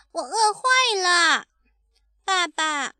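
put-on high child's whine; hungry baby dinosaur. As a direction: complain to daddy like a small child. (饿坏了的小恐龙撒娇)

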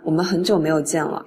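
ordinary delivery

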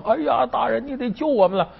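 high-pitched pleading wail, acted out; mock wailing. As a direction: plead for rescue in a hammed-up high cry. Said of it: "嚎啕大哭". (假装嚎啕，尖着嗓子求救)

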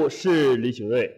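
ordinary talk

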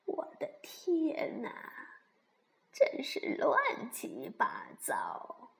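slow, breath-heavy granny dismay; old granny sighing over a mess; 熊婆婆，惊叹. Acted. (老婆婆慢慢地叹气)

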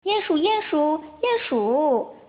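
a lightly voiced call. A narrator's reading. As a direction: call for a friend across the woods. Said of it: "阿尔菲喊着".

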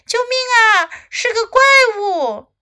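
high, loud panicked storybook cry; frightened scream. A narrator's reading. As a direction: scream for help as the scared dragon. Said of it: "他尖叫了起来".

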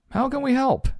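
very high, squeaky monster voice; squeaky storybook creature; helpful monsters. Acted. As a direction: offer help as a high-pitched little monster. Monsters arriving to offer help.

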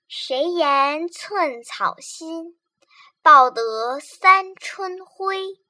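slow, heartfelt classical poem recital; slow emotional poem reading. Acted. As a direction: recite each line slowly and tenderly. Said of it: "请小咪再为大家朗诵一遍《游子吟》".

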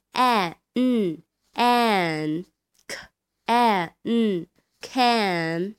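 very slow, drawn-out an and can; slow blending drill. Shown as a demonstration. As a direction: blend an into can slowly for kids. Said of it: "先读后面的an". (慢速拼读)

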